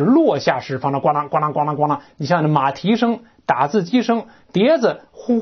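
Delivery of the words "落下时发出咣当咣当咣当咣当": clanging 咣当 noises; imitated clatter. Shown as a demonstration. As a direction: mimic things crashing down. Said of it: "硬物落地时".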